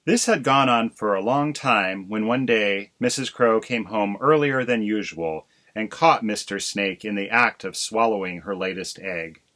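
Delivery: ordinary delivery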